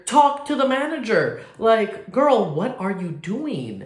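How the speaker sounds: high, loud, sassy exasperation; drawn-out sassy disbelief; sassy drag queen. Real, not acted. Exasperated retort to the woman.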